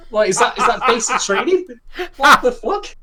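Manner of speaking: high, loud laughing outrage; laughing disbelief. Real, not acted.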